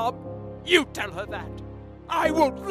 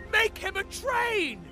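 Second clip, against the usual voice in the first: desperate plea; 12 semitones higher, 3 dB quieter than usual. Acted.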